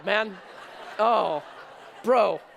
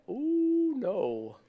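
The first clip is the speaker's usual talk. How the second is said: retold dismay; 3 semitones higher, 4 dB quieter than usual. Dad's retold dismay at son.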